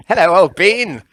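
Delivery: put-on old-chap character accent, slowly announced; jovial, hammed-up accent; [name]'s put-on accent. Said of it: "sorry about the accent".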